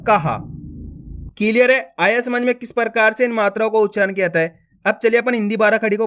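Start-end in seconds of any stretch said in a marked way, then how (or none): 0.0-0.4 कहा as ka followed by ha, falling in pitch; visarga as ha. Shown as a demonstration. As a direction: sound a separate ha after ka in कहा. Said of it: "का के बाद हा का उच्चारण निकालना है". (कहा में का के बाद हा)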